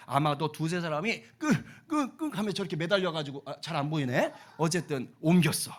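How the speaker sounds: usual speaking voice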